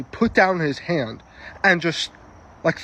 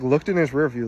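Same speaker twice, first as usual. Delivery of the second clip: normal talking voice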